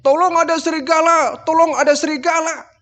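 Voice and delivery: very high desperate call; desperate cry. Retold or imitated. Boy calling the empty village in vain, desperate. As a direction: cry out with nobody coming. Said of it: "Teriak lagi dia".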